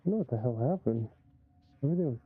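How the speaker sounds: muffled, fuzzy mic sound; muffled distorted mic. Real, not acted. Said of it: "I never sounded this muffled before".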